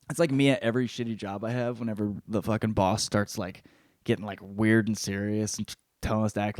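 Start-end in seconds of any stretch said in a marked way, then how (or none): none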